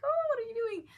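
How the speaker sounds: high, loud scandalized cry; shocked reader yelling at the book; mock shock.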